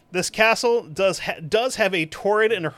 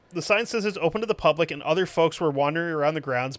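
normal talking voice

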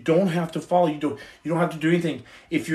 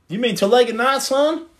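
louder and much higher, mock outraged; hammed-up correction; 9 semitones higher, 6 dB louder than usual. Correcting a viewer's story title, loud and teasing. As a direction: jump up in pitch on son, teasing. Comic movie-style correction.

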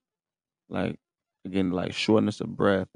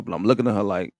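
ordinary talk, nothing marked

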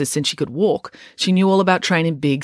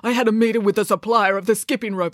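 put-on higher-pitched amateur Russian accent; bad Russian accent; 5 semitones higher than usual. A narrator's reading. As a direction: complain in a clumsy Russian accent. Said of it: "I'm very bad at Russian accents".

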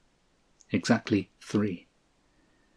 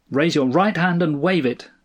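mildly thundered, higher-pitched order; thundering; 6 semitones higher, 3 dB louder than usual. A narrator's reading. Sky voice ordering Stanley to signal, mild thunder. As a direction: command from the sky, projected. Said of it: "thundered the sky voice".

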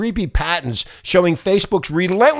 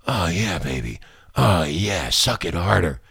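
mock sex talk; 13 semitones lower, 6 dB quieter than usual. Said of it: "I go".

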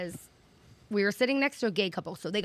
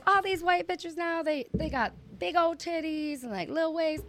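high, softer mock stage voice; imitated comedian's rant; 7 semitones higher, 5 dB quieter than usual. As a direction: mimic her riffing, pitched way up. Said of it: "And then she goes".

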